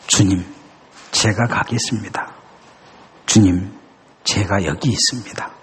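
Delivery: low, soft surrender; humble confession. Retold or imitated. Isaiah answering God, low and humbly. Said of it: "그렇게 고백합니다".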